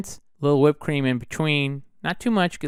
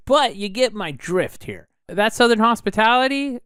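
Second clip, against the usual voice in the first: ordinary talk, nothing marked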